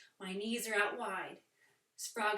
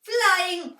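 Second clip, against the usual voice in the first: loud and high, soaring; excited; 9 semitones higher, 16 dB louder than usual. Shown as a demonstration. Teacher flying as an owl for kids, excited. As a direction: soar out the word.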